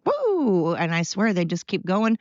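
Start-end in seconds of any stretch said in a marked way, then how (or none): 0.0-0.7 high, light "woo"; imitated "woo". Imitating three-legged dogs carrying on, high mock whoop. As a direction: toss out a high carefree whoop. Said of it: "they're just like".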